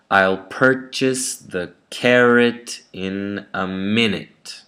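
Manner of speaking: slow sentence, strong first syllables in "purchase", "carrot", "minute"; first-syllable stress. Shown as a demonstration. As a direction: lean on PUR, CAR, MIN in "purchase", "carrot", "minute", slowly. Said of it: "the first syllable is stressed".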